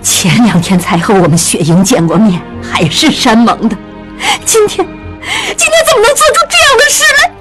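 high, shaken voice building to a fast outburst; outraged disbelief, voice shaking; trembling. A narrator's reading. Mother trembles with outrage at the betrayal. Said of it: "江母浑身颤抖着说".

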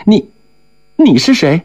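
put-on high, scared little-girl voice; frightened girl. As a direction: ask in a startled fright. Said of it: "噎着冷气儿惊叫了一声".

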